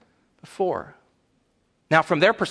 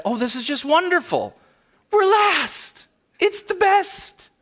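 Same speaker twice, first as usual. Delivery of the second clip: very high, squealing fake enthusiasm; mock delight; 13 semitones higher than usual. Wedding guest pretends joy at being called last, gushing. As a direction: gush absurdly high, as if thrilled to lose.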